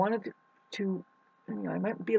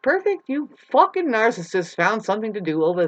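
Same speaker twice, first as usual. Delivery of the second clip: sarcastic jab; 5 semitones higher, 7 dB louder than usual. Retold or imitated. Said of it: "It's like".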